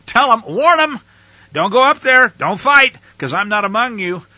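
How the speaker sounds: high, loud divine command; loud urgent warning. Acted. God warning Moses to stop the people, loud and urgent. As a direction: shout the warning as God would. God warns them not to go.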